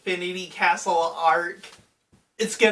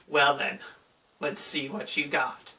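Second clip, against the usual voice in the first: lower, menacing villain line; lightly voiced villain; 5 semitones lower, 4 dB quieter than usual. The angry demon king dares the hero. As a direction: drop low and dare him quietly.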